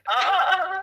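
surprised laugh. Real, not acted.

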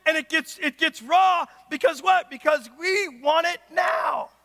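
forceful preacher's mock impatience; worked-up frustration. Preacher acting out demands over a stuck package, worked-up frustration. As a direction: push each demand out, stretching the last words. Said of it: "Our frustration gets real".

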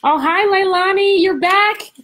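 high, loud happy hello; light up as she sees her; delighted greeting. Real, not acted. Teacher welcomes Leilani back on screen, high and delighted.

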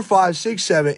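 ordinary delivery

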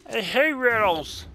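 loud, high exasperated cry; loud high I hate riddles; frustrated outburst. Real, not acted. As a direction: vent at the riddle.

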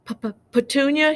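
high, stammering shocked question; gasping. A narrator's reading. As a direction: stutter the name in shock. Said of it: "he gasped".

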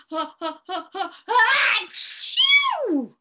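climbing breaths into a very high, loud achoo; big pretend sneeze. A narrator's reading. Storyteller voicing the snowman sneezing, exaggerated achoo. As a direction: wind up and let the sneeze explode. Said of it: "he went".

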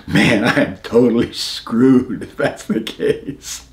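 slow, laughing through the words. Retold or imitated. Veteran laughing as he recalls his own thought, slowly. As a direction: chuckle it out slowly, amused at the danger.

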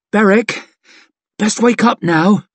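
tender, gentle urging; gentle waking. Acted. Chev gently wakes him.

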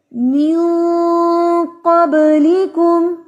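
मिन with the n hidden in the nose before क़ब्लिकुम; मिन क़ब्लिकुम with nasal ikhfa, recited; nasal hidden nun. Shown as a demonstration. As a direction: hum the n of मिन through the nose. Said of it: "नून की आवाज को हम नाक में छुपा रहे हैं".

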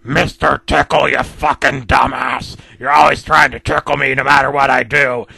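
loud, bitter tirade in a put-on voice; villain hurling insults; angry rant. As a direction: tell him off, fuming. Mister Grumpy's letter, ranting at Tickle.

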